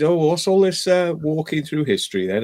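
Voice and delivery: teasing, pitched high and mocking; mock teasing question. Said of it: "I said".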